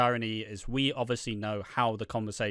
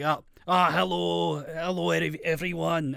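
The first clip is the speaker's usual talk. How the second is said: higher-pitched mock Scottish greeting; Scottish accent; 7 semitones higher, 3 dB louder than usual. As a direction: do a hammed-up Scottish wrestler, rolling the R. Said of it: "instead of Scottish person be like".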